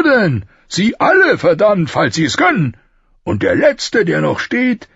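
loud, high, incredulous king; baffled bluster. A narrator's reading. King baffled that nobody would dare hit him, blustering. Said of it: "schien ehrlich verblüfft".

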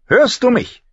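high, loud, impatient demand; sharp. A narrator's reading. Septa knocks and demands to be heard, sharply. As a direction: demand an answer sharply through the door. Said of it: "diesmal scharf".

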